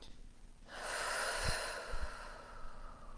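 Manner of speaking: slow, audible breath blown out; long breathy exhale. Shown as a demonstration. Leader showing listeners a deep breath, long exhale. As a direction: let a deep breath rush out softly.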